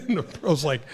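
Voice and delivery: ordinary delivery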